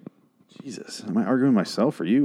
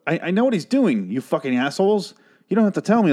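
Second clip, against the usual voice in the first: ranting rage; 8 semitones higher than usual. Real, not acted. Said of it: "I'm losing my voice 'cause of my rage".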